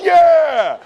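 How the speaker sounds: loud high cheer; group hype yell. Retold or imitated. The drunk friends cheering together, loud and high. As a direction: cheer like the whole table agrees. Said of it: "And everybody goes".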